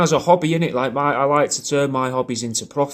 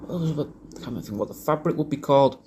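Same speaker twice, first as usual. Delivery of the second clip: thinking aloud; 4 semitones higher, 4 dB quieter than usual. Real, not acted. Searching for a word.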